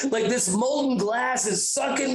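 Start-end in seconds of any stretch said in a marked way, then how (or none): none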